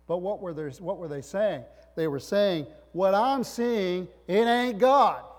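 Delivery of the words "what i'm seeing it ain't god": loud, slow, drawn-out declaration; high, forceful acted proclamation; emphatic denial. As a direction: deny it at preaching volume. Voices disciples' emphatic denial.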